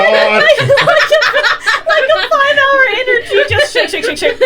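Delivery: high giggly voice; laughing. Real, not acted. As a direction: squeal it through laughter. Laughing, high-pitched silliness.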